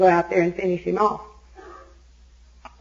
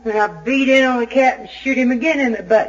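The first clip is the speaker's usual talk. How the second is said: ordinary talk, nothing marked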